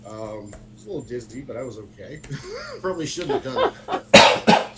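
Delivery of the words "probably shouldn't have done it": laughed out, much higher and louder; laughing. Real, not acted. Admitting to friends he rode off bleeding, laughing. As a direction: laugh through the admission. Laughing at own reckless choice.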